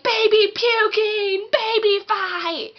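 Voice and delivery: loud, high, drawn-out mock kid yelling; rowdy little boys. Little boys playing with baby dolls, rowdy high hollering. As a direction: holler it like a boy wrecking a doll game. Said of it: "when little boys play with baby dolls".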